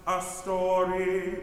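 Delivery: drawn-out speaking of the lyric; slow spaced-out phrasing. Shown as a demonstration. Choir director showing the rest after tells, slow. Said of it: "That gives us a place to put the S of tells then".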